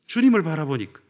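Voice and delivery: normal talking voice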